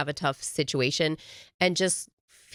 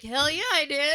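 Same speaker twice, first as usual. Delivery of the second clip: cocky boast; 9 semitones higher than usual. Retold or imitated. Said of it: "I'm like".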